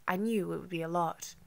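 plain reading voice

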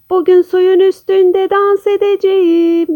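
high, sustained character call; ringing announcement. A narrator's reading. Kaju announces a dance to all, ringing out high. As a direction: call it out so it carries across the pond. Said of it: "Kaju'nun sesi yankılanmış".

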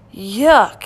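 loud, emphatic disgust. A narrator's reading. Bert tastes the gooey sundae, disgusted.

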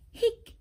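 sharp high squeaky hic; squeaky little hiccup; hiccup sound. Acted. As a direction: pop out a sudden hiccup. Kitty hiccups in story.

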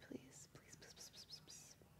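breathless whispered please, repeated; desperate hushed begging; whispered pleading. Real, not acted. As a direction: beg under your breath. Begging the power play to score.

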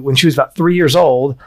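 ordinary talk, nothing marked

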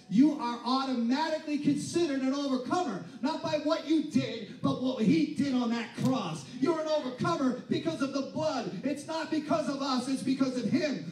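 loud, high impassioned preaching; shouted gospel climax; preaching at full force. Acted. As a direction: build the congregation up, full voice.

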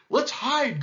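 high, urgent monkey cry; panicked cry. A narrator's reading. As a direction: cry out in alarm. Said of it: "cried the monkeys".

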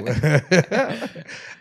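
loud burst of laughter; laughing. Real, not acted. Officer joking that the city is his, hearty laugh. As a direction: laugh out loud at your own bravado.

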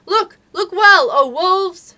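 very loud high cries; calling out. Acted. Anxious mothers echoing Akela's call to the pack, loud cries. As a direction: echo the call as a crowd. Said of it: "take up the call".

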